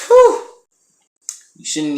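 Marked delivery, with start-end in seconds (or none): 0.1-0.5 very high, loud whistle-like whew. Real, not acted. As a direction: let out a shocked whew. Reacting to smart-mouthed guy.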